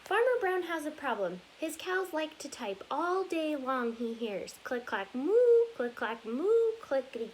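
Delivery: lively storybook read-aloud, rhythmic and bouncy; reading to children. Acted. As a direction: read it like story time for little kids. Reads picture book aloud.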